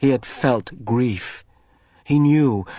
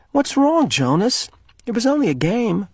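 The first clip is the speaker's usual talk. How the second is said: higher puzzled voice; concerned girl; 12 semitones higher than usual. Acted. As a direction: ask what is wrong. Girl asking worried friend.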